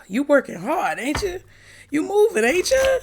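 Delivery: sassy teasing. Acted. Teasing the viewer playfully.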